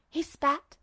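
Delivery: usual speaking voice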